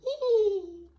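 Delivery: high, loud toy rabbit giggle. A narrator's reading. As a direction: giggle like a shy little bunny. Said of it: "She giggled again".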